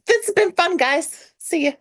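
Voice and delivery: high, hurried goodbye; a nervous girl backing out of the room; awkward exit. Retold or imitated. Fischl excusing herself from the group, high awkward goodbye. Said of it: "she's like".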